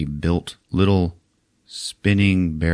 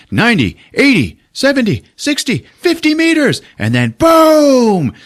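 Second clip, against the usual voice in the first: excited countdown to boom; 5 dB louder than usual. Retold or imitated. Retelling the monster hitting the ship, rising excited countdown. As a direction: build to the crash and slam boom.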